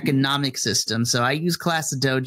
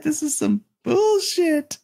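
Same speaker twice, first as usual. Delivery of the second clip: fed up; slower than usual. Retold or imitated. Teacher fed up at class.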